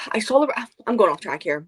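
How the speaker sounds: usual speaking voice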